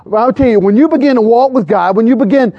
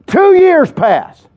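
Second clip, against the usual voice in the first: high and drawn out; dramatic announcement; 10 semitones higher, much slower than usual. Real, not acted. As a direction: stretch each word to mark the time jump. Storyteller dramatically marks time jump.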